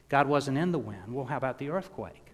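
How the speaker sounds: normal talking voice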